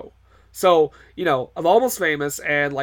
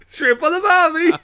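much higher hammed-up Australian accent; mock Australian accent; 12 semitones higher, 4 dB louder than usual. Laughing host hamming up an Australian cliche, high Australian accent. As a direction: squeak it out like a cartoon Aussie. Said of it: "do an accent".

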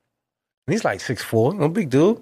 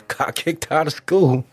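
normal talking voice

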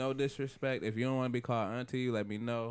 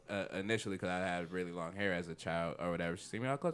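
normal talking voice

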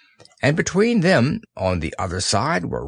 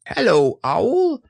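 very high squeak; excited baby squeak; squeaky Roo; 10 semitones higher, slower than usual. Acted. Roo speaking.

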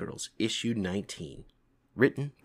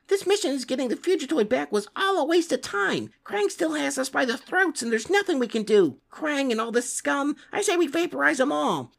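high heated rant; angry rant; 3 dB louder than usual. Acted. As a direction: vent the fury, hot-tempered. Zack rants in anger.

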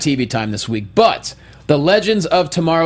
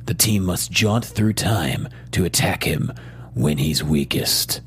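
movie trailer voice; 7 semitones lower than usual. Pretend. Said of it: "I'll do it in my movie trailer voice".

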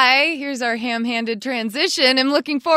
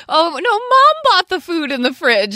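mock kid excuse; 6 semitones higher, 9 dB louder than usual. Mock parent excuse quote.

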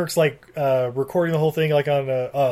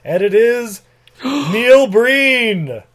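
high, loud, slow grand proclamation; Ben Judah; 5 semitones higher, 4 dB louder, much slower than usual. Retold or imitated. Rabbi revealing the Messiah on live broadcast, mock-grand announcement. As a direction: announce it with a gasp before the big reveal. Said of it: "Ben Judah says".

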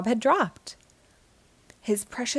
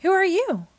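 puzzled question; 10 semitones higher, 4 dB louder than usual. A narrator's reading. SpongeBob lost his memory.

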